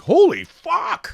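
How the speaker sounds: very high, slow amazed exclamation; astonished. Retold or imitated. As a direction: react to the sales. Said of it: "so they were like".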